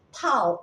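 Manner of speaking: "tall" read with an A, like "tal"; common mispronunciation. Shown as a demonstration. Teacher imitates how many people read it, "tall" as "tal". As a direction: say "tall" with an open A vowel. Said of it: "Banyak orang membaca tinggi".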